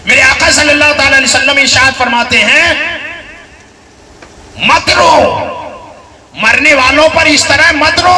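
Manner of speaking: loud, high impassioned sermon; fiery preaching. Acted. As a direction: build it up like a pulpit climax. Preacher proclaims Prophet's words.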